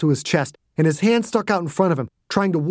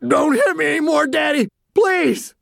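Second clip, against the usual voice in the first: put-on much higher, childlike wail; half scream, half cry; 13 semitones higher than usual. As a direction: plead like a beaten child. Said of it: "half scream, half cry".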